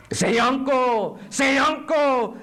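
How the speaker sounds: loud, high, drawn-out command; torturer demanding surrender. Acted. Interrogator forcing a prisoner to give in, loud slow shout. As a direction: shout it slowly, twice, like an order.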